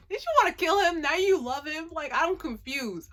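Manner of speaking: normal talking voice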